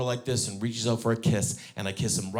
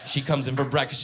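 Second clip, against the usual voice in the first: ordinary talk, nothing marked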